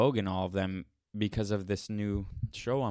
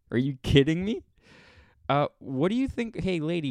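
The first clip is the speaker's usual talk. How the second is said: exasperated outburst; 5 semitones higher, 4 dB louder than usual. Real, not acted. Outraged at the review.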